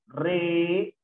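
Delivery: "reír" as re... ír, long pause; stretched, separated "reír"; syllable by syllable. Shown as a demonstration. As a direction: hold both syllables of "reír" apart. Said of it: "Cómo sería sonreír".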